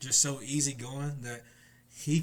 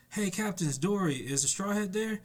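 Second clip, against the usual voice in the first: higher, eager call across the sea; calling out captain; 7 semitones higher than usual. Retold or imitated. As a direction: hail the ship, pitched up. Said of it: "Hydrugen's like".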